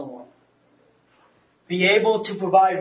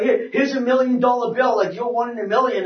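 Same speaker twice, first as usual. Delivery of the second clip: high, loud, acted gabble; re-enacted over-eager pitch; flustered retelling; 6 semitones higher, 5 dB louder than usual. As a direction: push it on him in a mock fluster. Retold frantic handing over of tract.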